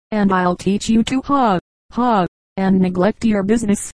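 plain reading voice

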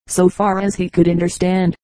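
ordinary delivery

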